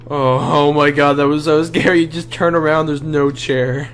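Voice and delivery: loud, high, rattled exclaiming; scared and shaken. Real, not acted. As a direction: say it, still freaked out. Just got scared by monster.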